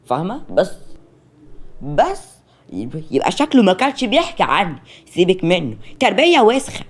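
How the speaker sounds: high, loud, nosy interrogation; pry with overbearing familiarity; brash great-aunt. Acted. Great-aunt questioning the new fiancée, brash and nosy.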